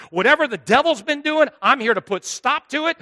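full-force preacher call; fiery preaching. Real, not acted. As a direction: rise up against the devil. Preaching climax against the devil.

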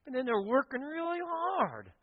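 high, whining complaint to Jesus; whiny Martha. Acted. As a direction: complain like a put-upon sister. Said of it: "She says".

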